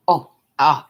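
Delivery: ordinary delivery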